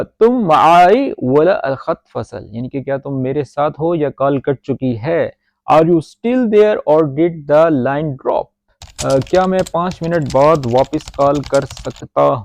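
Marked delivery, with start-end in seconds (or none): 0.0-2.4 slow model sentence. Shown as a demonstration. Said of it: "repeats the model sentence". 5.7-8.4 slow gloss. Shown as a demonstration. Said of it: "English gloss read slowly".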